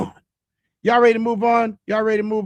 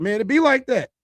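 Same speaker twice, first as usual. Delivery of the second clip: casual shrug; 6 semitones higher than usual. Retold or imitated. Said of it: "I'd be like".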